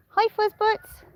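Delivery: high cooing greeting; pet talk. Real, not acted. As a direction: coo hello at the animal. Greeting an animal.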